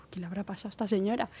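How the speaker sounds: quick, whispered wondering; whispered thought. Retold or imitated. Employee wonders privately about her boss, whispered. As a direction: whisper it fast, as if to yourself.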